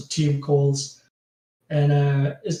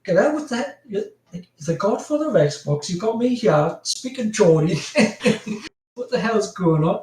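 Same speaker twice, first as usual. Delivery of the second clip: higher-pitched, incredulous retold protest; mock disbelief; 6 semitones higher than usual. Guest recalling Xbox godfather making him speak Geordie, mock disbelief. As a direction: protest as if you cannot believe it.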